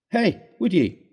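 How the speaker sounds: Irish doctor crying out; Irish accent. Acted. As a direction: bark it out in an Irish brogue. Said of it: "he cried in his own unmistakable accents".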